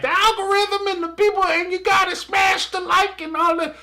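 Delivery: very high-pitched mock YouTuber voice; YouTuber impression. Host mocks YouTube people's algorithm talk, high mock YouTuber voice. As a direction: squeak it out, sending up YouTube talk. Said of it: "the YouTube people will say that".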